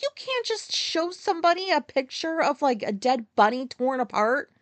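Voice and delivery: high-pitched indignant telling-off; unhappy scolding. Retold or imitated. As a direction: scold her, starting high in disbelief. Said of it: "I was not happy about that".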